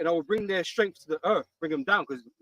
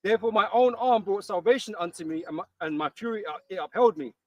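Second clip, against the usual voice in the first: firm, louder reading of the Bible verse; loud, solemn scripture reading; 4 dB louder than usual. Acted. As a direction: read it out like holy writ. Reads scripture aloud.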